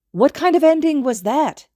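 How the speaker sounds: high, loud exclamation; can't believe how it ended; indignant high-pitched cry; outraged disbelief. A narrator's reading. Indignant outburst after finale.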